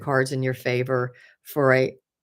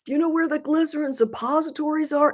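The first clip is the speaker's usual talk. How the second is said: much higher, louder call across the whole store; hollering across; 13 semitones higher, 4 dB louder than usual. Retold or imitated. Imitating the store clerk calling to Bill across the store, loud holler. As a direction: holler like a clerk shouting to a coworker far away. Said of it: "he hollers across".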